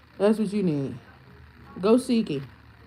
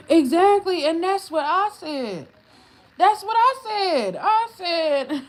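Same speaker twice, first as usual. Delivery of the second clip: much higher and louder, fired up; higher excited that's what I said; excited agreement; 9 semitones higher, 6 dB louder than usual. Real, not acted. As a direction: jump in, exactly right.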